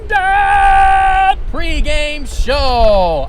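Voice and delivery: big show-host intro; announcer voice. Acted. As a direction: hype up the show opening. Podcast show opening announcement.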